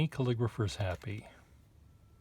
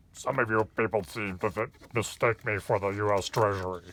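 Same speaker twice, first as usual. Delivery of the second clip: a gravelly character-actor voice, louder and gruff; Eugene Pallett impression; 6 dB louder than usual. Quoting My Man Godfrey to the viewer, gravelly impression. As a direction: growl it out like a grumpy old film star. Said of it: "He sort of talks like that, with a gravelly voice".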